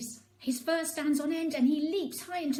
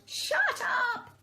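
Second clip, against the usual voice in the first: much higher angry shout; hammed-up neighbour yell; 12 semitones higher, 3 dB louder than usual. A narrator's reading. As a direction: yell from the windows at the dog. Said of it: "people lean out to shout".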